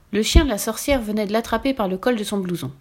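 usual speaking voice

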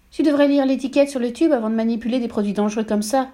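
voix d'épouse plus aiguë, sermonneuse; épouse donneuse de leçons. A narrator's reading. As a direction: fais la leçon avec condescendance. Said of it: "lui dit madame Verdebois". (madame Verdebois sermonne son mari sur les modes d'emploi)

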